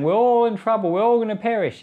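high, loud, panicky cry of doom; mock panic. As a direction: ham up a frightened crowd wailing. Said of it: "they're saying".

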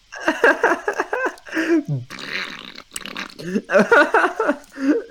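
long high-pitched laughing fit; helpless squealing laughter; real laughing fit. Real, not acted. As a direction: crack up completely. Laughing at stinky poo poo joke.

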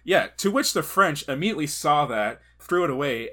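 ordinary talk

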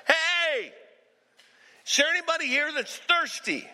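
high, calling out to the whole crowd; retold shout. Jesus calling out to the festival crowd, high ringing call. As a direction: call it out like a preacher hailing the festival. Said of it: "he shouted to them".

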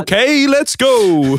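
high, loud hyped-up shout. Pretend. Mates joking about Van Gogh, a high loud hyped shout. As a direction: belt it out like a pumped-up cheer. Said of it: "He goes, he goes".

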